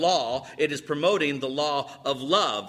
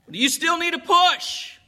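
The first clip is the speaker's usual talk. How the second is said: high, carrying question; calling out; 11 semitones higher, 3 dB louder than usual. Acted. Husband offers help into the rain, calling out. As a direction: shout the question up high across a yard. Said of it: "he calls out".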